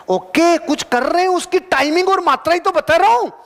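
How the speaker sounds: high-pitched, insistent comeback; emphatic retort. Real, not acted. Retort to imagined doubters. (ऊँचे सुर में ज़ोरदार जवाब)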